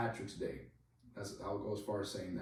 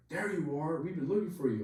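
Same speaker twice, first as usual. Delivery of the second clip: higher mock instructor call; imitated call; 7 semitones higher, 4 dB louder than usual. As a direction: call out as if you've found them. Instructor shouting by the helicopter.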